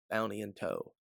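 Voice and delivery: ordinary delivery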